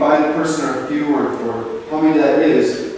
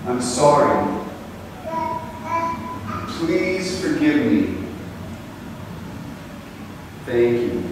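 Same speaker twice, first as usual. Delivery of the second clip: slow, softly falling mantra, long pauses between phrases; slow healing mantra; 3 semitones lower, 7 dB quieter, slower than usual. Acted. Showering the seated group with healing words, soft slow mantra. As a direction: let each phrase drop like a raindrop.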